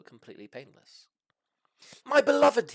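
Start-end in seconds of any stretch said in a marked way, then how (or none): 2.0-2.7 high, loud lovestruck cry; dragon crying out. Acted. Herbert crying out to his beloved, loud and high. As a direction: cry out to his lost mate. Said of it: "he cried".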